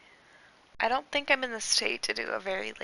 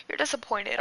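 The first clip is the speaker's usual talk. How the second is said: ordinary talk, nothing marked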